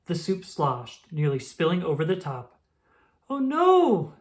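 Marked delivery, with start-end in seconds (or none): 3.3-4.1 a high, loud cry of alarm; scream. Acted. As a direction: scream it in fright. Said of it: "screamed Evergreen".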